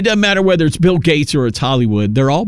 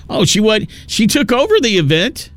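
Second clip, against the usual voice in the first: incredulous; 8 semitones higher than usual. Real, not acted. Reacting to [name]'s speech.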